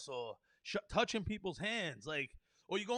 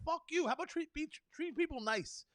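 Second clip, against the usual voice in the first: sudden high fuck-you; angry outburst; 8 semitones higher than usual. Real, not acted. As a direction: spit it out at them.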